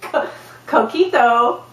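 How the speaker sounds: laughing, slow American-accented "coquito". Shown as a demonstration. Speaker mocking herself, laughing "coquito" in an American accent. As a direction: stumble into "coquito" with English vowels, giggling. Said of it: "I'm like this".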